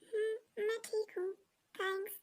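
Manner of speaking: holding back tears. Acted. Heartbroken girl holding back tears.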